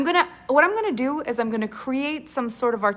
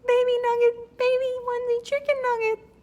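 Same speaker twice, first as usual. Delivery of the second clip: much higher cutesy coo; cooing baby talk; 13 semitones higher than usual. Pretend. Host coos baby talk about nugget onesies.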